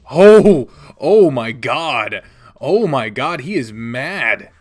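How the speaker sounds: loud, slow gasping exclamations; excited shock. Real, not acted. Player reacts to Shuichi snarling, loud excited shock. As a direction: blurt out astonishment loudly.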